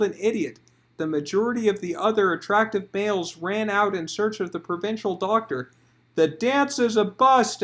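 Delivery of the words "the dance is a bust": loud, high announcement; raised announcing voice. Acted. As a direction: declare it to the room. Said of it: "announced the Archduke".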